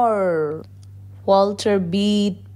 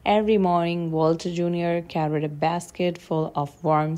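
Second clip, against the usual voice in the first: ordinary delivery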